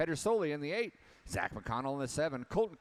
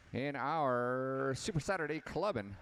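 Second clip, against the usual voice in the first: ordinary talk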